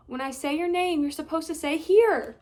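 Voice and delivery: calling out. Acted. Boy calling instructions.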